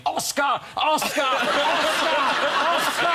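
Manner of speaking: high, loud panicked yell, imitating the rider; shouting. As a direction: mimic someone bellowing at a runaway horse. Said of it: "pelting down the beach shouting".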